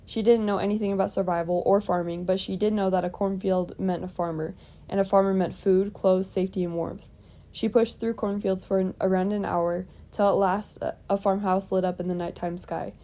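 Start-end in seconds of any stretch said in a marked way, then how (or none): none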